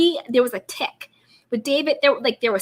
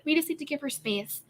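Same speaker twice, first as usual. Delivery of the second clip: mock Rinna; 7 dB quieter than usual. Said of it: "Rinna is like".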